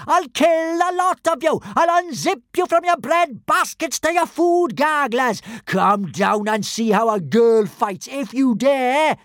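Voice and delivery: high, loud, raging threat; furious yell. A narrator's reading. Kamikaze yells threats at the mocking crowd. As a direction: scream the threat at the top of her lungs. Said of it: "yelled at the top of her voice".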